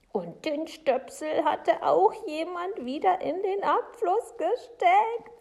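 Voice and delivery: high, loud, drawn-out ending; spooked Frau Segebrecht. Acted. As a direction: stretch the last words in disbelief. Woman recounts uncanny events.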